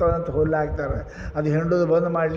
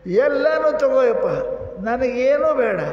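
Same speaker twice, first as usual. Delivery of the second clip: higher, louder wailing appeal; weeping plea; 8 semitones higher, 4 dB louder than usual. Acted. Starving king crying to Kubera at night, wailing plea. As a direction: beg the god through tears. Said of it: "ಒಂದು ಸಾವಿರ ಅಳಕ್ಕೆ ಚಾಲು ಮಾಡಕತ್ತ".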